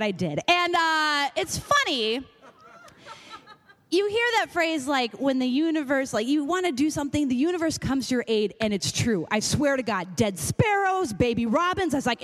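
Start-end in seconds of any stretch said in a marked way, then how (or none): none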